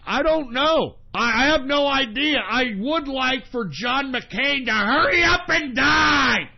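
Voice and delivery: high, worked-up radio rant; exasperated rant. Real, not acted. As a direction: build from exasperation into a drawn-out shout. Exasperated rant answering a question.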